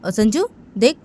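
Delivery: high-pitched, indignant questioning; acted family telling-off; exasperated scolding. Elder scolds daughter-in-law, acted quarrel.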